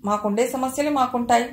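ordinary delivery

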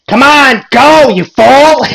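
high, loud hammed-up yell with a drawn-out end; yelling great aunt. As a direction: imitate an angry aunt shouting at strangers. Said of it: "she's, like, yelling at these people".

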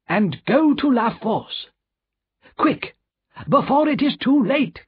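urgent plea. Acted. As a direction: build to a high, desperate urgency. Lorry urges doctor to act.

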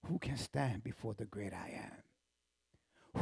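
hushed, whispered question; awed whisper. Acted. Preacher in awe of the great I am, whisper. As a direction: breathe it in awe.

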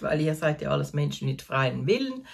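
usual speaking voice

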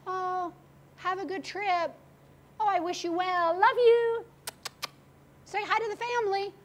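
mock cheery farewell. Mock cheery send-off to reckless driver.

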